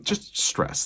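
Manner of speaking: a high, unvoiced sigh of stress; breathy aside. Real, not acted. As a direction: let the words escape as a tired, whispered exhale. Stressed aside about the election.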